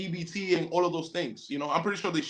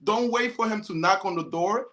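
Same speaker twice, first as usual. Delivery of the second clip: usual speaking voice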